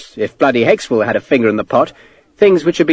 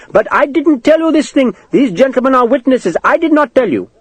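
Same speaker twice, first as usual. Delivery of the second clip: higher, anxious Indian clerk voice; a timid clerk pleading his innocence; frightened clerk; 12 semitones higher than usual. Acted.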